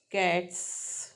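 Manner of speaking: कैट्स said slowly, with a crisp voiceless ts; careful teacher model of कैट्स; slow cats demo. Shown as a demonstration. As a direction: stretch out कैट्स so the final ts hisses. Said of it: "phonetic transcription देखें".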